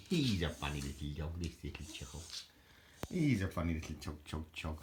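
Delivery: soft, low cooing to a pet dog; doting dog talk. Real, not acted. Owner coos softly to her little dog, tender pet talk. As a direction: coo fondly over the little dog.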